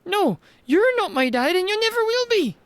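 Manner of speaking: put-on high, defiant young girl; girl rejecting the mayor as her father, high and defiant. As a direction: refuse him flatly as the girl, high.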